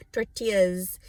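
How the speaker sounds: mock gringo pronunciation of "Tortillas". Shown as a demonstration.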